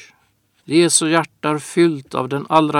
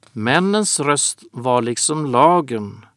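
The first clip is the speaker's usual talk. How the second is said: usual speaking voice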